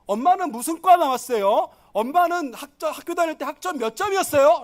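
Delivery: mock child's comeback; cheeky child. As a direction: turn the question back on mom, building. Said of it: "라고 물어보라고 가르칩니다".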